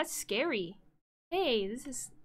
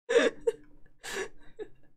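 high airy laugh; 11 semitones higher, 4 dB louder than usual. Real, not acted. Laughs at own weird quote.

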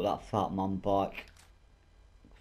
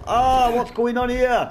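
alarmed shouting; 12 semitones higher, 8 dB louder than usual. Real, not acted. More enemies appear.

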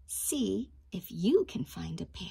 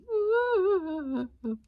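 high, louder giggle; bright laugh; 10 semitones higher, 4 dB louder than usual. Shown as a demonstration. Teacher laughs for children, showing laugh. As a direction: laugh out loud for them.

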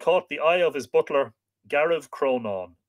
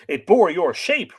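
urgent reply; 7 semitones higher, 7 dB louder than usual. Acted. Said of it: "replied Gariv Cronon".

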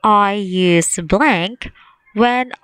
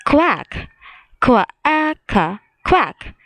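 mock quacking a little higher; duck quack; 5 semitones higher, slower than usual. Shown as a demonstration. Teacher imitating a duck's quack for the kids. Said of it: "ducks makes the sound quack".

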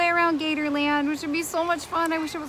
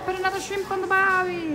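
hammed-up Australian accent, pitched high; terrible Australian accent; 7 semitones higher than usual. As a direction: do a bad, jokey Aussie impression. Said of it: "I do a terrible Australian accent".

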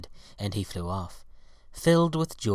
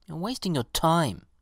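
higher-pitched, mildly scornful pansy; 7 semitones higher than usual. A narrator's reading. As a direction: dismiss the effort with a touch of disdain. Pansy scoffs at the violet.

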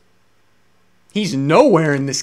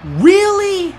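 much higher and louder, disbelieving; incredulous outrage; 12 semitones higher, 7 dB louder than usual. Real, not acted. Fan outraged at the dropped classic match, incredulous yell. Disbelief at missing main event.

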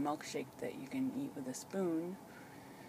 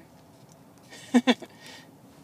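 breathy laugh; 8 semitones higher, 8 dB louder than usual. Real, not acted. Filming groggy person after surgery.

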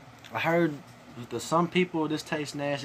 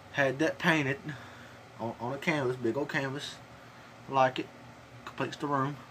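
tobacco pouch in lip; talking around lip bulge. Real, not acted. Seen 4: Lower lip bulges throughout; he holds an empty spit bottle.

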